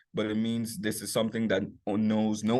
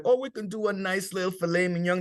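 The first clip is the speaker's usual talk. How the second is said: lilting and higher, offering a fancy dish; fancy chef offer; 7 semitones higher than usual. Retold or imitated. Private chef offering clients fancy dish, lightly put-on voice. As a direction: pitch it up like a polished private chef. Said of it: "and sometimes I be like".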